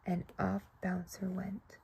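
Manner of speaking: soft unvoiced aside; hushed storyteller whisper. A narrator's reading. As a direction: whisper him off on his way. Bunny sets off quietly.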